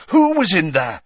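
loud, high, insistent question; urgent demand. A narrator's reading. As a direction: demand an answer at once. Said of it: "the urgency in Harry's voice".

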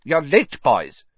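high-pitched teacherly telling-off; reproving. Acted. Professor Flitwick scolds the late boys, reproving. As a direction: scold briefly, dropping on the last word. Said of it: "said Professor Flitwick reprovingly".

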